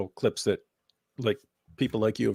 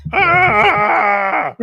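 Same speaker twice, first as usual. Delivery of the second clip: high loud fake cry; mock shriek; 7 semitones higher, 9 dB louder than usual.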